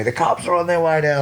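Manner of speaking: high shouted warning from above; high mock yell that the cops are coming; retold scream. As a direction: yell it down the hill, mock panic. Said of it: "he's screaming out at me".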